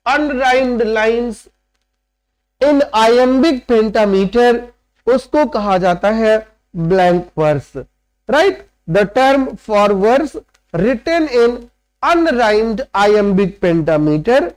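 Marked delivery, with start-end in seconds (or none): 0.1-4.6 each word spaced out, very slowly; slow dictation. Shown as a demonstration. Teacher to class, dictating the term very slowly. 8.9-14.4 very slow, every word drawn apart; slow dictation. Shown as a demonstration. Teacher dictating the definition to students, very slowly.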